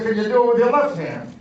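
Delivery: called out loud and high from a car window; calling out. Retold or imitated. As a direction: shout it across the street to a passerby.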